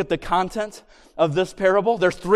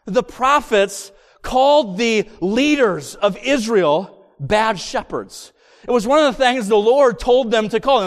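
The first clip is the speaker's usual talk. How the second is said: normal talking voice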